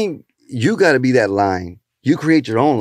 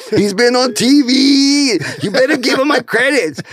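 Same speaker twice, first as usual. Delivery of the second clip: much higher, hyped stage announcer; hyped emcee intro; 11 semitones higher than usual. Retold or imitated. Comic on stage introducing the next act, high hyped emcee voice. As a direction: sell the next act like a club host. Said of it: "So I went on stage".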